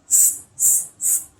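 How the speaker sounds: loud voiceless hisses; monkey hissing. A narrator's reading. Monkeys copying the angry peddler, hissing. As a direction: hiss hard in separate bursts. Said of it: "And they said".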